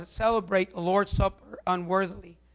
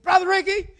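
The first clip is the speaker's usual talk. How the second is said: high, louder excited call of his name; excited widow; 10 semitones higher, 6 dB louder than usual. Retold or imitated. Widow greeting pastor, high excited voice. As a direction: imitate the widow gushing with joy. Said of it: "man, I'm so happy".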